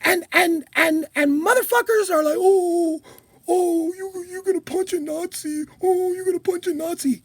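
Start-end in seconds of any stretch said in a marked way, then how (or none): 2.4-5.7 mock whiny voice. Mocking whiny opponents. 5.8-7.2 fake-sobbing mock whine; mocking a critic with fake crying. Mocking whiny opponents, crying.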